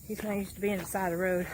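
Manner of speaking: ordinary talk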